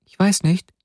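put-on high, small girl's voice; high-pitched childlike reply; little girl. A narrator's reading. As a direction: answer as a puzzled little child.

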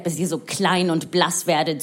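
usual speaking voice

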